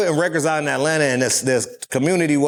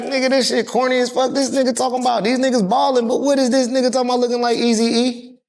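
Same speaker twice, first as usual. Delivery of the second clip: higher, scoffing mockery; mocking locals; 10 semitones higher than usual. Atlanta locals mocking the West Coast rapper, higher scoffing. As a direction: sneer at the corny outsider. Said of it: "they like".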